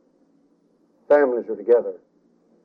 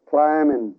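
usual speaking voice

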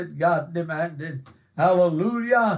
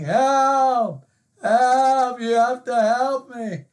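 high, loud, desperate yell for help; frantic cry for help; 6 semitones higher, 4 dB louder, slower than usual. Retold or imitated. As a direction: scream it like a drowning man going over the falls. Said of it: "he is frantically crying".

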